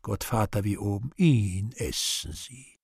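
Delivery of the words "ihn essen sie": slow rote repeating; mechanical. Acted. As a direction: drag it out like a machine. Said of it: "Gottvater mechanisch".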